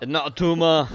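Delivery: loud Austrian action hero; Schwarzenegger impression. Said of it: "Apologies to Mister Schwarzenegger".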